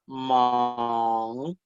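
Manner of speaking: "man" held long and low, then rising; nasal second tone. Shown as a demonstration. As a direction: stretch the nasal ng at the end of "man". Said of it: "como es un tono nasal".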